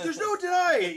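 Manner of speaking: loud, high delighted cry; dad thrilled by his daughter, loud and high; excited exclaim. Retold or imitated. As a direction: burst out laughing with pride. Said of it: "I was like".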